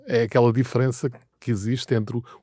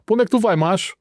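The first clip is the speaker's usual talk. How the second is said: higher, mock taxi driver's call; imitated taxi driver; 8 semitones higher, 4 dB louder than usual. Taxi driver to the newcomer beside his cab, imitated cabbie voice. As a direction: imitate a local cabbie's blunt question. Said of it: "E ele disse-me assim".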